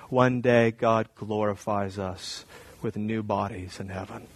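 slow, soft, reverent preaching; solemn preaching litany. Acted. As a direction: let each truth land slowly and softly.

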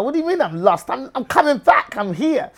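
high, loud acted protest; indignant retort. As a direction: act out an offended comeback. Retorts to being called lost.

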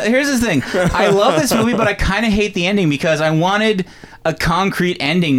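usual speaking voice

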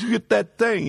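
high mock Italian, pushing the point; mob guy voice. Friend retelling Pat's mob-voice line to Sonny. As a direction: say it like a wiseguy hint. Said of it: "He goes".

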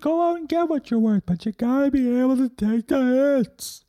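high, strained movie-hero pep talk; Sylvester Stallone. Acted. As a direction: belt out a motivational movie quote. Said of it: "Sylvester Stallone".

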